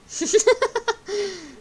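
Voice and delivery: laughing. Real, not acted. Cracking up at being called stupid.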